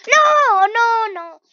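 very high, loud wail of defeat; anguished no. Real, not acted. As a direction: cry out as it all fails. Dies after hard part. (grito de desesperación)

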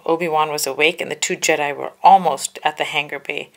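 ordinary delivery

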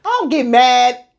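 high-pitched protest; indignant denial. Real, not acted. Wife contradicting husband in front of others, indignant. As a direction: deny it as if wrongly accused.

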